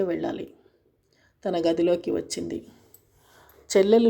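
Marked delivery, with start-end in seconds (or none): none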